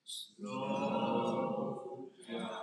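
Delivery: long, lazy, drawn-out slow down; said real slow. Shown as a demonstration. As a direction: stretch every sound like a slow chant. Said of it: "Let's say it real slow".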